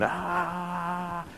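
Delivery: a sudden high startled yelp; decoy yelling. Retold or imitated. Decoy flailing at a biting dog, sharp high yelp. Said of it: "He's yelling".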